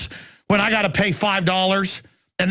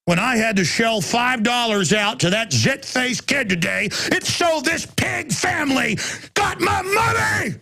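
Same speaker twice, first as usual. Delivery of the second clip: furious shout; 8 semitones higher than usual. Real, not acted. Radio host ranting to listeners about paying for parking, furious shout. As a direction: yell it out, pitch cracking with fury.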